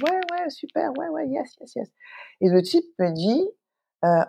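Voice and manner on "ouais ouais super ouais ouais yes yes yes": high, soft, breezy yeses; tipsy mock enthusiasm. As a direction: gush yes over the champagne glass, much higher. Tipsy toast, saying yes to all. (enthousiasme éméché, voix haute et douce)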